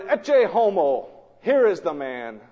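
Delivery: loud and slow, presenting the man to the crowd; triumphant proclamation. Acted. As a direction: proclaim "Ecce homo" like a showman expecting applause.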